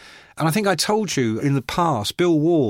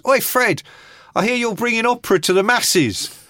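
loud, higher punk taunt; hammed-up Sid, higher and louder; imitating Sid; 8 semitones higher, 4 dB louder than usual. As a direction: shout the jibe across the room, cocky. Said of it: "Sid comes in and he goes".

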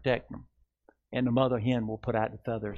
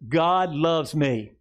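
very slow, raised words to echo; very slow declaration; 4 semitones higher, 4 dB louder, much slower than usual. Shown as a demonstration. Pastor leading the congregation, very slowly. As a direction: stretch each word out slowly. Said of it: "Say this".

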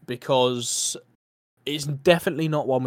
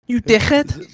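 loud, high Scouse driver's rant; acted Scouse road rage; Scouse accent; 9 semitones higher, 6 dB louder, slower than usual. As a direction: tear into someone in hammed-up Scouse. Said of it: "rendition of him getting out of the car".

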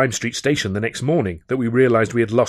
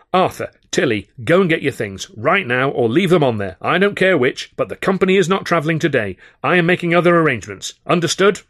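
1 stern commanding order; 6 semitones higher, 6 dB louder than usual. A narrator's reading. Alf stamps his authority.

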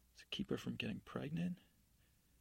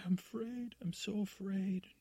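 high, frightened cry, slowing on the second line; a girl breaking down in fear; frightened plea; 8 semitones higher, 5 dB louder than usual. Acted. Cinderella admits her fear to her stepmother, high and scared. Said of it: "she's just like".

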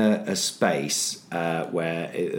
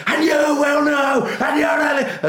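much higher, louder, slow drawn-out scream; imitating shrieking prophecy; 5 dB louder, slower than usual. Nell screaming doom at the audience, slow shrieked imitation. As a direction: stretch each word into a witch's wail. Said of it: "starts screaming this stuff".

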